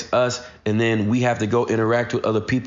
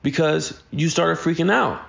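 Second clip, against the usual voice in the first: higher-pitched mock scolding, climbing at the end; exasperated parent; 6 semitones higher than usual. Parents to daughter who freaked out over nothing, exasperated. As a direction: replay a parent's worked-up lecture, pitch raised throughout.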